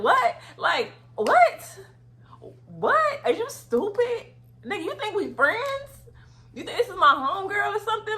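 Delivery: high, loud, disbelieving yell; incredulous shout. Retold or imitated. Shouting at the manager over the hotel room, loud and disbelieving. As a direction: yell it like you can't believe he'd do this.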